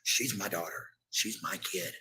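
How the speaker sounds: ordinary delivery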